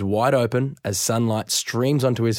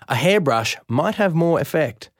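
plain reading voice

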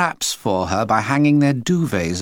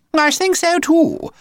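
high, slow, louder simpering mum; prim pampered lady; posh mother voice; 5 dB louder, slower than usual. A narrator's reading. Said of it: "added Missus Frightfully Busy".

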